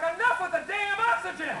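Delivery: high-pitched, exasperated outburst; fed-up retort. Retold or imitated. Brother sick of hearing about oxygen, exasperated. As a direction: cut him off, fed up. [name] snapping back at brother.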